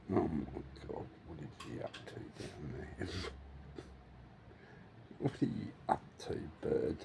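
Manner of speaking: low hushed whisper. Real, not acted. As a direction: whisper low, not to disturb. Very soft low voice.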